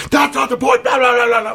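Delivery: high, loud mocking shout; imitating the cyclist. Cyclist dismissing his apology, high loud mock rant. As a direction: rant back at him in a high mock yell. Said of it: "I go".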